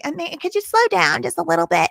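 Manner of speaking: high-pitched mock judge; interrupting judge. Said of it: "the judge had the nerve to interrupt her".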